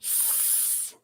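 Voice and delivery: "s" as a quiet unvoiced hiss; letter sound S. Shown as a demonstration. Teacher sounds out S in district, a soft hiss. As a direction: hiss the "s" softly. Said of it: "sounds of S".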